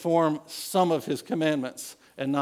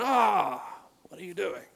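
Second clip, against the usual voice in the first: high sharp "Ah" then a dropped, softer question; exasperated rebuke; 5 semitones higher, 3 dB quieter than usual. Retold or imitated. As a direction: scold like a fed-up prophet. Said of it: "coming to Saul and going".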